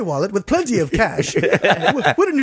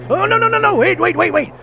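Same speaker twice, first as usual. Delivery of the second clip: panicked pleading; 11 semitones higher than usual. Acted. Dying warrior begs not killed.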